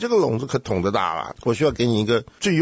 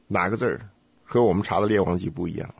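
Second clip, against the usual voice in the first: normal talking voice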